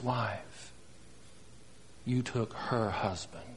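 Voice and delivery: low, hushed, slow indictment; quiet accusations with heavy pauses between them; accusing prophet. Acted. Nathan the prophet confronting David with his sin, quietly accusing. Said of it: "Nathan the prophet said".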